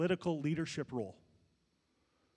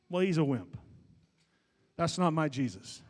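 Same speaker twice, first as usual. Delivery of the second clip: ordinary talk, nothing marked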